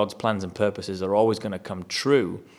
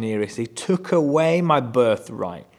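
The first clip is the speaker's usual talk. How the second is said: slow, louder, wounded complaint; emotional protest; 2 semitones higher, 5 dB louder, slower than usual. Retold or imitated. Esau in the moment of emotion, slow wounded protest. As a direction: drag out the grievance. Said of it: "in his emotion".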